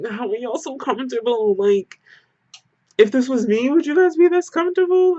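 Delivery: high, incredulous mock outrage; exasperated disbelief. Retelling her shocked question to the calm friends, high and incredulous. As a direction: squeal it at the friends like you can't believe them.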